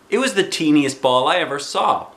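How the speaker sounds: usual speaking voice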